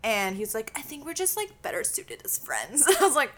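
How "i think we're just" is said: ordinary delivery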